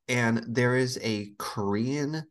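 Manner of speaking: ordinary talk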